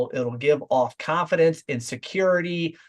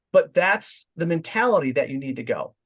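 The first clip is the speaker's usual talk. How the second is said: ordinary talk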